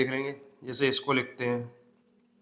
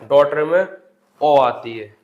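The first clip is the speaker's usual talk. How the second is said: slow and loud, ओ in daughter leaned on hard; stressed ओ; 7 dB louder, slower than usual. Shown as a demonstration. Teacher stressing the ओ sound in daughter to students, slow loud emphasis. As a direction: drag out daughter में ओ आती है, hitting ओ. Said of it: "ठीक है".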